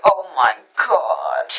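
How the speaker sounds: slow, low, weary dismay; exasperated disbelief. Retold or imitated. Woman reacting to her friend believing the shapeshift, exasperated.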